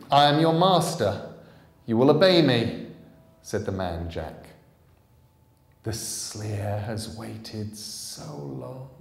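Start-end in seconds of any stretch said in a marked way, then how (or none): 0.1-2.9 loud, higher commanding voice. Acted. Jack commanding the Sleer. 5.8-8.9 slow, triumphant triple voice; triumphant creature. Acted. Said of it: "the triple voice of the Sleer triumphantly".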